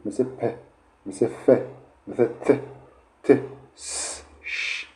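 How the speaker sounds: پ, ف, ت, س, ش breathed out without voice, fading; soft, voiceless sample sounds; voiceless consonant demo. Shown as a demonstration. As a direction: hiss out س and ش with no voice. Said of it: "مثل چی؟".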